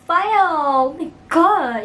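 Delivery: slow, loud, drawn-out outrage; outraged. Real, not acted. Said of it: "outrage at pedophile".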